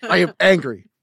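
loud, high mock-angry echo; hammed-up imitated anger. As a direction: mimic a friend's outburst, exaggerated. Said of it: "That literally defies our entire relationship".

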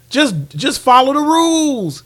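high, drawn-out plea; emphatic plea. Real, not acted. Podcaster urging friends to follow the rules, emphatic plea. Preaching the moral of the story.

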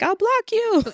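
high, defiant mock retort; mock snap. Girl threatening the guy who teases her, high mock snap. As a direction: fire it back like a sulky threat.

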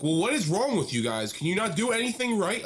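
loud, high imitated tirade; hammed-up rant at the staff; angry yelling. Angry customer berating the Burger King staff, loud mock yell. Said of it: "losing his shit on them".

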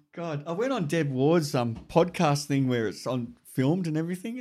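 ordinary talk

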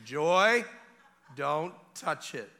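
loud name, then slow command; stern warning. Retold or imitated. Father to baby at the cabinet, loud stern warning. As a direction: call out the name, then space the order out.

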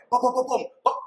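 string of high, popping booms; rapid-fire sound effect; imitated gunshots. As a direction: mimic repeated shots by mouth. Imitating continued gunfire.

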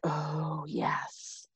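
soft, near-whispered approval; hushed relish. Retold or imitated. Replying to a great dress suggestion, hushed relish. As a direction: drop to a whisper, savoring the idea.

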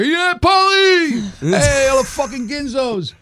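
high, loud, drawn-out cheer; mock fan yell. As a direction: holler it like a rowdy fan across a room. Acting out fans calling out.

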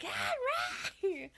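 very high, stretched call; hammed-up screaming for someone, no real volume; mock scream. Imitating Alex screaming for Henry outside, mock scream. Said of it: "Like, screaming".

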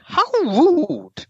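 high drawn-out posh "How rude". Shown as a demonstration. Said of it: "Say it properly".

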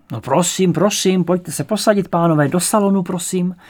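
effusive, high-pitched welcome; fussy old hostess. A narrator's reading. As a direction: usher them in with repeated little urgings. Old lady ushers guests in.

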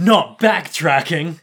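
loud, slow, dramatic exclamation; mock dismay. Co-host reacting to the rat's trick, mock dramatic. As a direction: play up the horror with great weight.

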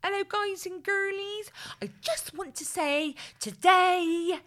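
high, slow, building into a loud, mock-tearful gush; Sophie Anderson impression. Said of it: "I'm sure you could do one without".

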